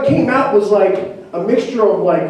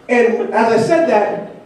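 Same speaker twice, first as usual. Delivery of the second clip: usual speaking voice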